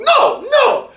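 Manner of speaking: very high squealed no no; mock no no. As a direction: squeal the catchphrase. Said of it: "throwing his book".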